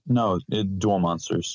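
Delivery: ordinary delivery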